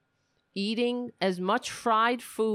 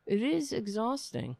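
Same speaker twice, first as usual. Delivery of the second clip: ordinary delivery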